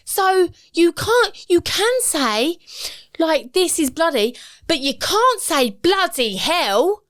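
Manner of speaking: imitating son. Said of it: "he just goes".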